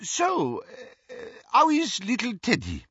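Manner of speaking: high, transparently casual question; over-bright social chit-chat; forced small talk. A narrator's reading. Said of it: "wildly transparent attempt to break the tension".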